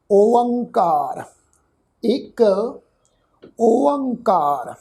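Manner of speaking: ਓੰਕਾਰ drawn out slowly, syllable by syllable; careful recitation. Shown as a demonstration. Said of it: "ਇਸ ਤੋਂ ਅੱਗੇ ਪਾਠ ਆ ਜੀ".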